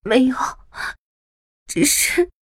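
halting, feigned hurt; fake hurt hesitation. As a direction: fake aggrieved stammer, pausing between words. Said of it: "又故作十分委屈地说".

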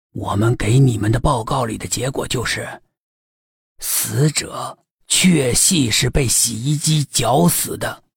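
put-on low, steady old chief's voice; old police chief. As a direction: answer like a seasoned old cop.

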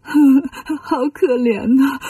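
slow, hammed-up sobbing; acted crying. A narrator's reading. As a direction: wail with pity between mock sobs. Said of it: "就哭了起来".

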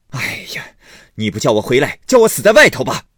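exasperated groan bursting into a loud, high shout; angry yelling. Acted. Husband snapping at his wife's question about coming home, shouting angrily. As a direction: blow up at the nagging question. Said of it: "贾琏嚷道".

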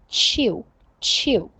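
"chew" with T and you fused into a ch sound; linked T and you. Shown as a demonstration. Teacher showing how T plus you links, linked ch sound. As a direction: say "chew" slowly as one ch-glide syllable. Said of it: "T和you在一起要变成chew".

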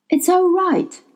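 usual speaking voice

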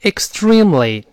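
slow model. Shown as a demonstration. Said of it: "那大声来一遍".

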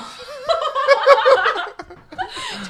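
loud high-pitched laugh; cracking up; burst of high laughter. Real, not acted. Laughing at teacher imitation.

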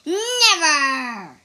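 loud, sharp refusal; defiant shout. Acted. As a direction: fling the word back in defiance. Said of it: "said the demon".

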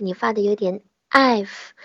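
normal talking voice

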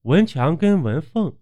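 usual speaking voice

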